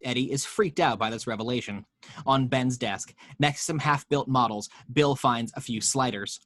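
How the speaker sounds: ordinary delivery, nothing marked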